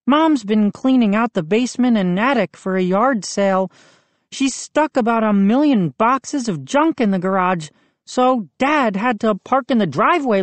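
worried friend explaining, lightly played; boy Moose. A narrator's reading. Moose voiced by narrator.

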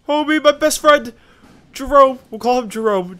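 high, loud cheering cry; excited shout. Pretend. Gamer cheering a fleeing unit, high excited shout. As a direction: shout for your buddy to make it.

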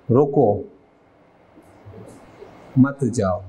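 "रुको", a clear stop, then "मत जाओ" together; pause after रुको. Shown as a demonstration. Teacher showing how a pause changes meaning, pause after "रुको". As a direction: break right after "रुको", keep "मत जाओ" as one phrase. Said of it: "तो मतलब change".

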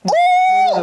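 a high, loud squeal of protest; shrieked laughing no. Real, not acted. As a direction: cry out no, half laughing.

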